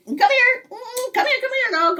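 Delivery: high coaxing call; calling the dog. Retold or imitated. Calling the family dog, high coaxing call. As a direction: coax the dog over. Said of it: "we call our dog".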